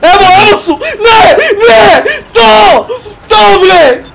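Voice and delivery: excited shout. Real, not acted. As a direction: shout it out as the kill lands. Excited shouting during gunfight win.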